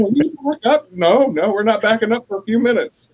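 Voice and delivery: high-pitched hammed-up squabble; mock bickering. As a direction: play-act a heated back-and-forth. Acted quarrel replayed.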